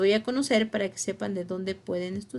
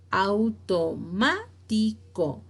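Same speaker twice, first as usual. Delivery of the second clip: "automático" slowly in separate syllables; split into syllables; much slower than usual. Shown as a demonstration. Teacher repeating the example, "automático" syllable by syllable. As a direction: spell out "automático" syllable by syllable, lifting "má".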